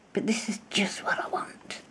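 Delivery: breathy whispered aside to herself. Real, not acted. Crafter whispering over her new fabric. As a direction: hush it as if telling a secret.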